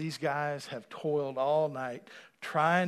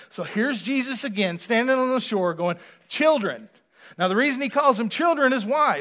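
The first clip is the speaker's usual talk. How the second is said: preaching at full force; 7 semitones higher, 12 dB louder than usual. Acted. Preacher revealing what Jesus really says, loud and fervent. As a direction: proclaim it to the congregation.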